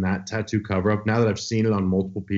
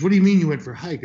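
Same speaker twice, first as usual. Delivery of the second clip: fast, high and louder in disbelief; exasperated doctor; 8 semitones higher, 5 dB louder, much faster than usual. Retold or imitated. Doctor scolding the patient who went hiking, fast and incredulous. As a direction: rattle it off like a doctor who can't believe it. Angry doctor voiced in story.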